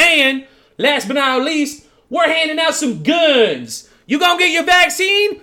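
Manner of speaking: high, hammed-up Southern sales pitch; put-on Southern accent. Mock Southern official pitching the raffle.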